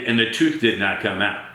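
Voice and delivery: ordinary delivery, nothing marked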